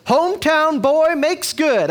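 mock-proud small-town cheer; cheering townsfolk. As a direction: announce it like a proud banner headline. Town cheering hometown boy.

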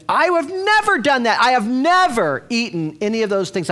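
high, loud indignant Peter; Peter protesting. Retold or imitated. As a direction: insist he has NEVER done it. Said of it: "he's saying".

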